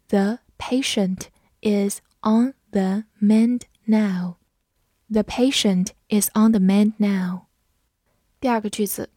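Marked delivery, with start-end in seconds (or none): 0.1-4.4 slow, careful read of the sentence. Shown as a demonstration. Teacher reading slowly for students to follow. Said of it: "我们慢读一遍".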